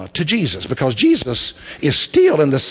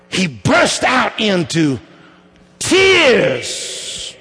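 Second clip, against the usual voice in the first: high, rising preacher's build; preaching at full force; 8 semitones higher, 3 dB louder than usual. Acted. Preacher telling the church Jesus was angry, rising preaching. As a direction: climb in pitch, pressing the point home.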